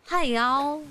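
high, twangy Southern greeting; Southern American accent. Shown as a demonstration. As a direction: put on a Southern US drawl. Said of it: "that kind of accent?".

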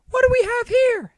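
high falsetto woman's voice, loud and hurried; put-on female doctor. Doctor to paramedics as gurney rushes in, hurried loud falsetto. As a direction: pitch it way up as an urgent female doctor. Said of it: "I have very few female voices".

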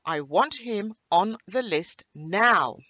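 loud, slow, emphatic demand; furious Santa. A narrator's reading. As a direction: order the elves about as an angry Santa. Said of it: "he was furious with his elves".

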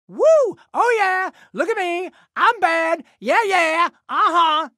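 high, whooping show-off celebration; boastful celebration. Acted. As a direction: whoop and brag in short high bursts. Celebrating and showing off.

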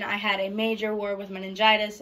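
ordinary talk

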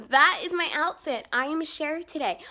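usual speaking voice

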